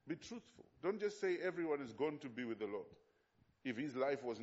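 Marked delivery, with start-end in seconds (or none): 0.1-0.7 quiet. Shown as a demonstration. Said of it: "I tell all the young pastors".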